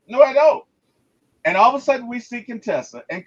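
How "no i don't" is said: higher and louder sheepish no; clueless Robert. Retold or imitated. Robert answering the team, cluelessly higher. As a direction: blurt an oblivious no. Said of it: "And he's like".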